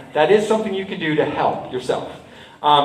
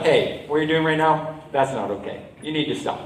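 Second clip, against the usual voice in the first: low, softer, steady and calm; calm and firm; 3 semitones lower, 5 dB quieter than usual. Shown as a demonstration. Telling a bully to stop, calm and firm. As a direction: set a boundary without heat. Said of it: "as calm as possible, just be like".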